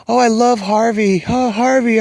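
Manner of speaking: high, gushing mock adoration; gushing fan. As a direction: swoon over Harvey like a fawning fan. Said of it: "everybody's like".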